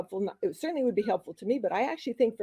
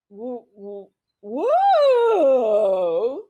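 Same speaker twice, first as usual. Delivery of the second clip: warm-up woo; 9 dB louder, much slower than usual. Shown as a demonstration. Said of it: "What did you do?".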